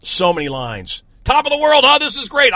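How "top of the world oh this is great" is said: high, loud acted line; loud movie quote. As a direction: belt it like a movie character. Quoting movie lines loudly.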